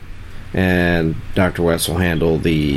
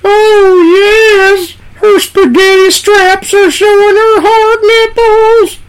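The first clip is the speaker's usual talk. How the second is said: very high, loud sing-song shriek; high falsetto manager voice; 9 dB louder, much slower than usual. Pretend. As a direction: squeal it out slow and loud. Paul Bearer parody voice.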